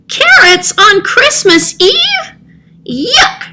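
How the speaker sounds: high, slow cat voice spitting out disgust; a cat sneering at carrots, with a laugh; disgusted spit. Acted. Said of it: "spat Mercury".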